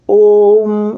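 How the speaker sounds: "ओम" drawn out on one sustained level note; prolonged vowel. Shown as a demonstration. Teacher demonstrating the triple-length vowel, "ओम" held long. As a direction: hold the ओ of "ओम" three times as long. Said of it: "इस तरीके से".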